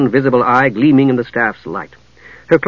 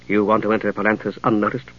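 low, cultured woman's voice; 4 semitones lower, 6 dB quieter than usual. A narrator's reading. Catherine asks Steele.

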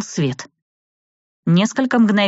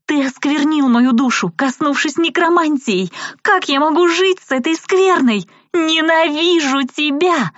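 screaming; 11 semitones higher than usual. A narrator's reading. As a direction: hurl the accusation at him, shrieking. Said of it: "выкрикнула она".

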